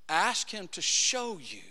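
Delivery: normal talking voice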